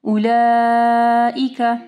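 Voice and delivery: उलाइका with the laa held very long before the hamza; slow chanted उलाइका, stretching the middle vowel; long madd. Shown as a demonstration. Teacher shows the stretch to students, long madd. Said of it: "लंबा करना है चार से पाँच हरकत के".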